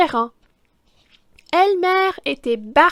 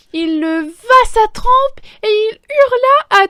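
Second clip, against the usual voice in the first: loud, higher, building excitement; loud climax; 9 semitones higher, 9 dB louder than usual. A narrator's reading. Narrator reading to children, the trumpet climax, loud. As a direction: belt out the big moment.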